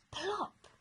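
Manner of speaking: ordinary delivery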